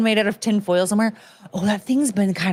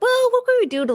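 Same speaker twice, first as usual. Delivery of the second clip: much higher mock voice of herself; high quote; faster than usual. Streamer retells her baking idea, high mock voice. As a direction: lift into a squeaky self-imitation. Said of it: "So I was like".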